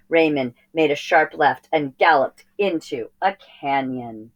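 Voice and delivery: ordinary delivery, nothing marked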